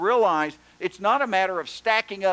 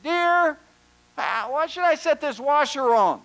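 high, raised voice calling to his wife; yelling back; 8 semitones higher, 5 dB louder than usual. Retold or imitated. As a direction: shout a question through the house, acted out. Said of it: "he yells back".

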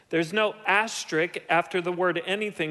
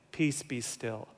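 quieter, lower, gentle "Peace, be still."; soft gentle hush; 7 semitones lower, 7 dB quieter than usual. Shown as a demonstration. Pastor shows the gentle translation he rejects, softly. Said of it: "Jesus isn't saying".

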